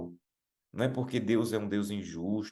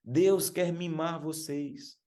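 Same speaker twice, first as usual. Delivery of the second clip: much higher, slow mock yell; imitated shout; 8 semitones higher, much slower than usual. Preacher repeats the singer's cry to the youths, imitated shout. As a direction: stretch each word high, imitating her call. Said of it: "E gritava".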